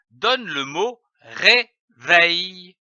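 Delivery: « réveil » lu lentement en deux syllabes; étirez « réveil » posément; lecture lente. Shown as a demonstration. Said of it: "L'association de ces deux syllabes". (« ré-veil » détaché, lent et clair)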